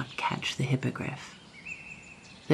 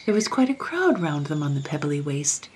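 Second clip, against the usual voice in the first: ordinary delivery, nothing marked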